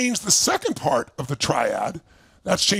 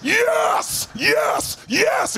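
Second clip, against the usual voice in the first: shouted yes; 13 semitones higher, much slower than usual. Retold or imitated. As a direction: roar it out. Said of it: "it's like".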